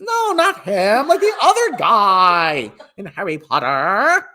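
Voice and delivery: put-on Valley Girl voice rising at phrase ends; drawn-out Valley Girl uptalk; Valley Girl upward inflection. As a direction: swoop up into questions like a Valley Girl. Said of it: "everything's an upward inflection with her".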